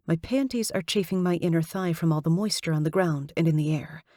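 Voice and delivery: plain reading voice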